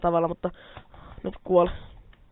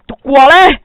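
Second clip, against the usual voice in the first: high, much louder yell with an odd put-on edge; battle shout; 6 semitones higher, 14 dB louder, slower than usual. Real, not acted. Gamer kills a pig, shrill put-on battle shout. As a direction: yell it at the pig as you strike. Player attacking pigs in game.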